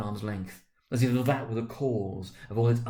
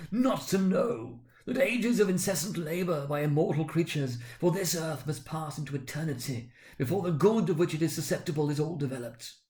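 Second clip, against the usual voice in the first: much higher, grieving spirit; impassioned ghost; 7 semitones higher than usual. Acted. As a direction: plead it like a damned soul preaching.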